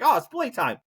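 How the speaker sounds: high, eager playful dog; excited dog voice. Retold or imitated. Dog thinks the scolding means playtime, high eager voice. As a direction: squeal it like a dog ready to play. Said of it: "Or he's like".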